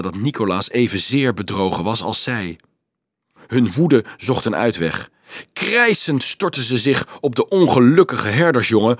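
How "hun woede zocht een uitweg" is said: ordinary delivery, nothing marked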